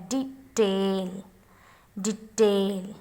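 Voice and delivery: detail said low with a drawn-out syllable; detail, carefully stretched for a learner; stressed syllable. Shown as a demonstration. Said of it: "because stress is here".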